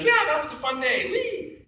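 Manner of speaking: high, laughing innocent delight; cheerful oblivious kid squealing whee; naive giddy. Retold or imitated. Naive Ben recalling fun.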